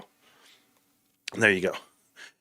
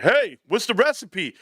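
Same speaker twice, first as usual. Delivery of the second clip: eager; 10 semitones higher than usual. Retold or imitated. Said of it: "everybody was like".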